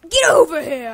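lightly voiced Scorpion shout; Scorpion catchphrase. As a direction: bark the fighter's catchphrase. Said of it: "I'm Scorpion".

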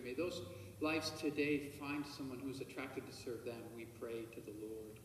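ordinary delivery

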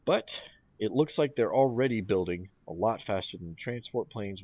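ordinary delivery

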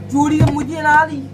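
loud, high mock Geordie; Geordie accent. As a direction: tease in an exaggerated Geordie accent. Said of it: "I've been doing the Geordie accent".

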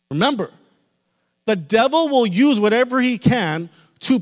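fiery preacher lifting the church; preaching at full force. Acted. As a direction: drive it home to the congregation.

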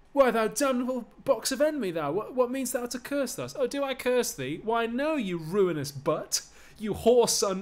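two men screaming insults at each other; shrieking insult match. Acted. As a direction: hurl the insults up high and hard. Patroclus and Thersites trading insults.

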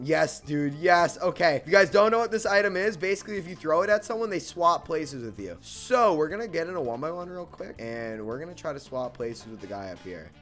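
normal talking voice

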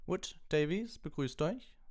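high, cheerful referee call; snappy and upbeat like a referee; brisk Madame Hooch. A narrator's reading. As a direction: referee greets the two captains, high and brisk. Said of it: "sagte Madame Hooch beschwingt".

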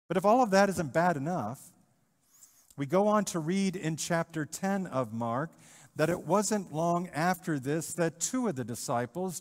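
ordinary delivery

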